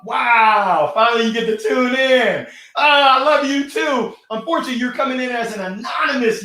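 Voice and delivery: high, loud delighted shout; loud, thrilled welcome; excited. Real, not acted. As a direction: greet them with a burst of joy. Excited greeting to a viewer.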